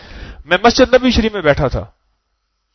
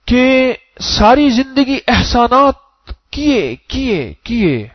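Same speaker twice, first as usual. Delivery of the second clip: drawn out; 7 semitones higher, much slower than usual. Acted. Preacher stretches repeated word.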